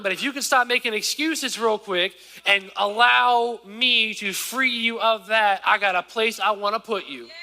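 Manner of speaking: high, loud preacher voicing God; God speaking through the preacher; preaching. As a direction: lift the congregation as God's promise. Preaching God's plan.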